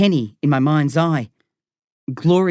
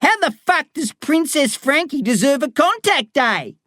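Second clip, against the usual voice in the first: higher, lightly voiced furious inmate; barking; 11 semitones higher than usual. A narrator's reading. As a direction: spit out the outrage. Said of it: "she barks".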